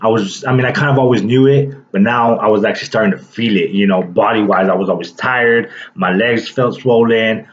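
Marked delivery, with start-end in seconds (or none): none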